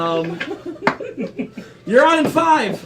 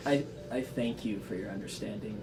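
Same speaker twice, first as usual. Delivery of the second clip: very soft, low orc voice; quiet orc voice; 11 semitones lower, 18 dB quieter than usual. Acted. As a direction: murmur it like a gentle orc. Orc character thanks, very quiet.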